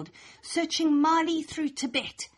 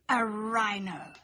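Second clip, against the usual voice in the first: a gasp, then a slowed, amazed "A rhino"; gasp of discovery; 3 semitones lower, slower than usual. A narrator's reading. Child narrator hitting on a new pet, gasping in wonder. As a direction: struck by a sudden idea, slow and awed.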